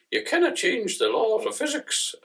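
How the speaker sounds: a hammed-up attempt at a Scottish engineer; Scotty. Quoting Scotty to explain the limits of physics, mock Scottish accent. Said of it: "as Mister Scotty used to say on Star Trek".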